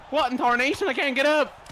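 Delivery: high, loud alarm; exaggerated panicked protest; comic disbelief. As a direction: cry out as if helplessly stuck. Knocked down, cannot get up.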